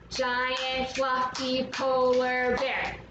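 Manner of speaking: "Giant fluffy polar bear" in slow, even claps; syllable by syllable. Shown as a demonstration. Teacher redoes it to count, syllable by syllable. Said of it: "let's do it again".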